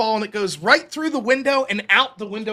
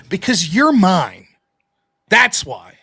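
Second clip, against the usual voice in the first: slow, firm, a bit louder; firm dad; 3 semitones lower, 5 dB louder, much slower than usual. Retold or imitated. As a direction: lay it down slowly like a father. Dad's firm answer retold.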